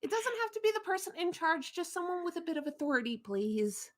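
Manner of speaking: in-character entreaty, starting high; pleading request. Acted. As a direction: plead politely and hurriedly. Remy pleads for someone in authority.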